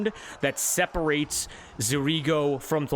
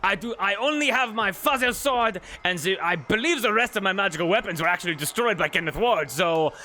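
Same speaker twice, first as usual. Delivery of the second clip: higher, quick eager voice; lightly raised Duke voice, eager to fight; 5 semitones higher than usual. Acted. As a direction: answer keenly as the Duke. Playing the Duke in character.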